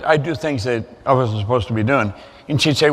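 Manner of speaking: normal talking voice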